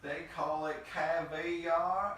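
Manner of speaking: slow, high, hammed-up southern twang. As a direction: drawl it out in an exaggerated Southern accent. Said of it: "like, a southern twang now".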